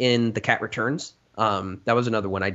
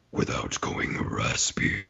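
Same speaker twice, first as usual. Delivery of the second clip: very low voice; 13 semitones lower, 8 dB quieter, slower than usual. Shown as a demonstration. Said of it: "go lower".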